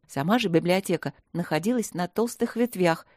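ordinary delivery, nothing marked

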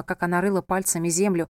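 usual speaking voice

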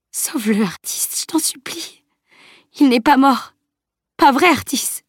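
high, breaking plea rising to a cry; desperate pleading. A narrator's reading. Camille begs Artis to save Salim, desperate high plea. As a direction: beg him in rising panic. Friend pleads over dying boy.

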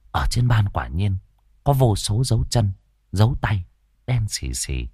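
ordinary delivery, nothing marked

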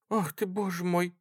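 high, light, fussing exclamation; flustered old woman. A narrator's reading. Old woman reacts to the soldier's story, flustered. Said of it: "засуетилась старушка".

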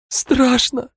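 high, cracking, terrified; voice breaking with fear. Acted. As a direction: blurt it out in panic. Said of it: "Голос Хромого срывался".

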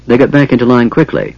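ordinary delivery

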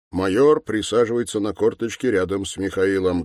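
ordinary talk, nothing marked

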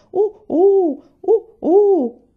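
loud, high hooting owl call. Acted. Owl calling from the trees by the creek, hooting. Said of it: "un altro gli rispondeva".

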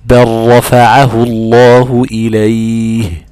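بل رفعه said as barrafa'ahu, the lam merged into the ra, slow. Shown as a demonstration. Teacher recites a verse showing lam merging into ra. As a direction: recite slowly with the lam absorbed by the ra. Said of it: "وفي الراء للتقارب مثل".

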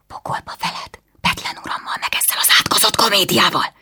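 furious hissed whisper. A narrator's reading. Anna hisses back at her brother, whispering. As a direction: spit it out under the breath. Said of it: "sziszegte vissza Anna".

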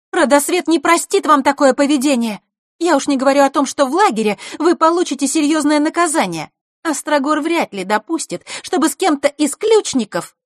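high, loud indignant scolding; Диана's outraged outcry. A narrator's reading. Girl warning the boys of punishment, indignant outcry. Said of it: "первой выкрикнула Диана".

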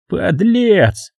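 high spat-out insult; contemptuous shout. A narrator's reading. Hals shouting at Lindberg, high contemptuous. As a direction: curse the deserter. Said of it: "крикнул Гальс".

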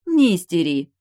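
loud, cheeky jab; cheeky grin. A narrator's reading. Highborn bride taunts the man she just bit, cheeky and grinning. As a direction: say it loudly through an impudent smile. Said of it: "нахально улыбнулась Высшая".

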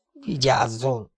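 restrained warning through pain; pained hiss. Acted. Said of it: "sziszegte fájdalmasan a férfi". (fájdalmasan sziszegve)

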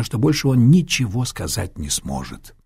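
plain reading voice

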